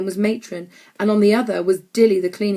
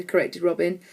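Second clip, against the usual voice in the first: plain reading voice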